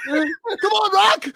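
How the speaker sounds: high-pitched cheering cry; yelling at the TV. Acted. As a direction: playfully cheer on a movie boxer. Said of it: "I'm, like, yelling at my T V".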